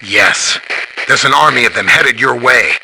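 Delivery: filtered radio transmission; radio report. Acted. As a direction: call in a sighting over the comms. Reporting over a radio link.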